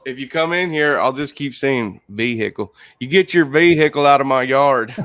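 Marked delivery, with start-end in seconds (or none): 3.0-4.9 put-on Southern accent. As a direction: bark it like a Southern mama. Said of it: "someone with a Southern accent says vehicle".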